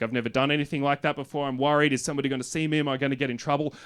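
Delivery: normal talking voice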